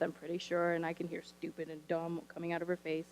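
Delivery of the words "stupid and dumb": low mutter of cuss words; grumbled almost inaudibly; muttered under breath. Retold or imitated. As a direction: mumble them under your breath like a sulky kid. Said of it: "cussing up a storm under her breath".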